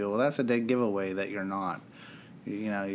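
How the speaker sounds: normal talking voice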